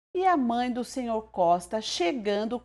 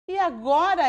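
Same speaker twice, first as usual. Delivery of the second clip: exclaimed; 6 semitones higher, 4 dB louder than usual. A narrator's reading. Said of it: "ela exclamou".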